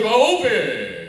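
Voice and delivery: very loud, drawn-out preacher; slow, hammed-up preacher holler. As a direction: boom and stretch it like a pulpit call. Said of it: "That's the way he thought".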